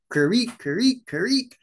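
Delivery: high, squeaky "creek, creek, creek", accent on the second syllable, rising; imitating tern call. Shown as a demonstration. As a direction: mimic a screechy seabird call. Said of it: "the elegant tern should be like".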